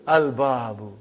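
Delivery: Al-babu with a clear "l" before "b"; lam pronounced. Shown as a demonstration. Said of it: "You did pronounce lam".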